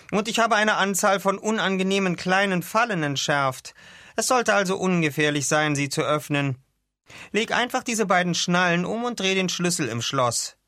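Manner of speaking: high-pitched, formal doctor voice; Doctor Skamandros, explaining. Acted. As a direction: explain your precautions like a careful expert.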